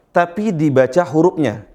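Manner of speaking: usual speaking voice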